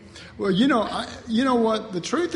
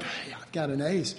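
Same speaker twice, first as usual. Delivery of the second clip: smug aside; 6 semitones lower, 8 dB quieter than usual. Retold or imitated. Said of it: "it's like".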